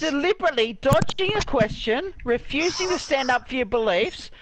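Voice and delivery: high-pitched, heated tirade; real anger. Real, not acted. Accusing the other player of deliberately dodging, real rising anger. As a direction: build into a fast, furious outburst. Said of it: "you wonder why I'm fucking angry".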